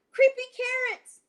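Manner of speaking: high, loud frightened shout; terrified kid shouting. Acted. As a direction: yell it at the wall in terror. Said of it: "he shouted".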